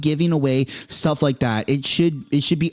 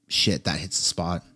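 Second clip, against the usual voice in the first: ordinary delivery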